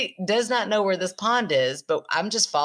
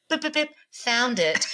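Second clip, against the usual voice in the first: put-on kid voice; 8 semitones higher than usual. Middle child with new phone.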